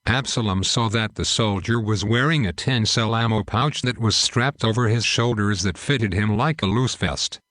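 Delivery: usual speaking voice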